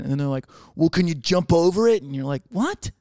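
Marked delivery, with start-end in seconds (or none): 0.7-2.0 mock boss. Boss voiced on phone call. 2.5-2.9 stunned what. Retold or imitated. Reacting to boss's demand.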